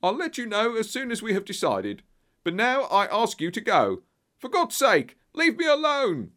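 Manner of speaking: high nervous dismissal building to a loud, desperate outburst; Pinner. A narrator's reading. Pinner sends the visitors away, bursting into a desperate cry. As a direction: hold it back, then let it burst out. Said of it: "These last words were shot out of him".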